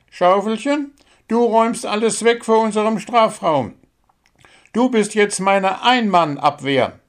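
a bird coach praising and correcting a player; small bird coach. Acted. Coach bird giving the squirrel feedback.

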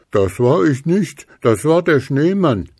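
Gruseli denying. A narrator's reading. Gruseli insists to Stachelchen it was the snowman. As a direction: protest your innocence. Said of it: "behauptete Gruseli".

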